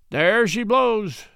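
high, loud, slow call; cried out. A narrator's reading. Matt calling out at the arriving steamboat, loud and drawn out. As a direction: sing it out as the steamboat comes into view. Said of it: "Matt cried".

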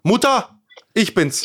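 real high, loud call to another room. Real, not acted. Son calling his mother during the podcast, calling out. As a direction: holler up for your mother.

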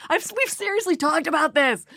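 high, strained husband in her head; exasperated husband. Retold or imitated. Imagined husband scolds the chatty auditioner, exasperated. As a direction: plead like a husband at the end of his patience. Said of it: "my husband's voice is in there".

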